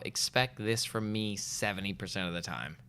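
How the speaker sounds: ordinary talk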